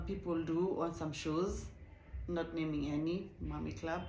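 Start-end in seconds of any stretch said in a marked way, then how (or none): none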